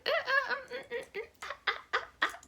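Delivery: high flustered stammer; stammering noises. Retold or imitated. Mom stammering in shock, high and flustered. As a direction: sputter in shock. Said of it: "she's like".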